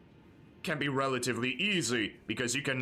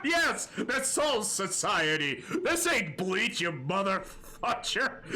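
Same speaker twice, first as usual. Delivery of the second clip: laughing; 6 semitones higher, slower than usual. Real, not acted. Cracking up at own joke.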